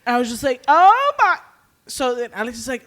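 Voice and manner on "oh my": high, loud, cut-off exclamation; horrified cry. Retold or imitated. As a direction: start a big scream and stop short. Retells own cut-off horrified cry.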